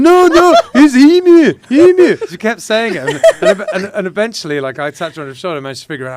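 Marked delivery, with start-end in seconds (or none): 0.0-2.2 high, loud, insistent Scottish correction. Retold or imitated. Scottish fan insisting on her name, loud Scottish. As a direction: keep insisting in a Scottish lilt, higher. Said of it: "she was going".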